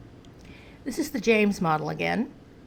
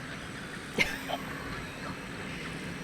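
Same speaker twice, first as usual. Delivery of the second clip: airy giggle, much higher; soft unvoiced giggle; high breathy laugh; 12 dB quieter than usual. Real, not acted. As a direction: laugh at yourself. Laughing at own pet name.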